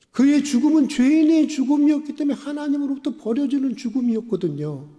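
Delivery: high-pitched, urgent pulpit voice; impassioned preaching. Acted. Preacher explaining Jesus was truly forsaken, impassioned preaching. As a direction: press the truth on the congregation.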